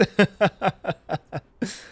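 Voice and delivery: high, bright chuckle of delight; fond laugh. Acted. The hare laughs remembering his friend's riddle. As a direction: laugh at the old riddle with fondness.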